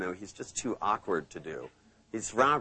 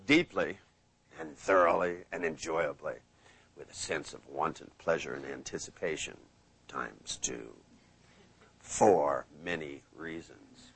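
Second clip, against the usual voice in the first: hypnotic trance voice; 6 semitones lower than usual. Shown as a demonstration. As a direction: lull them down one notch at a time. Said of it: "I like to say".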